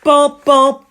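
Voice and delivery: loud sung dun-dun; ominous musical sting, belted; dramatic sting. Acted.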